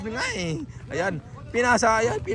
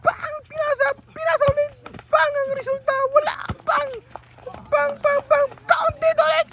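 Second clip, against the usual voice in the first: high-pitched rapid bursts of match calls; excited play-by-play; slower than usual. Real, not acted. Commentator calling shots up to a score, excited. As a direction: fire off each shot and pass excitedly.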